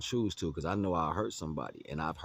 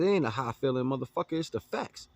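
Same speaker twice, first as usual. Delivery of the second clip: raised, louder comeback, climbing high at the end; retold yell; 7 semitones higher, 5 dB louder than usual. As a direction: fire it back at him, loud and high. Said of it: "I said".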